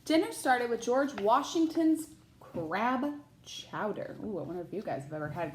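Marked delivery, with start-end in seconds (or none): 0.0-4.2 put-on bright, lively read-aloud voice for children; picture book narration; storybook reading. A narrator's reading. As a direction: read it like story time for a class.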